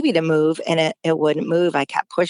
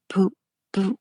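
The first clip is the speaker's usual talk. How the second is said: monitor beep. Retold or imitated. Imitating faint fetal heartbeat monitor.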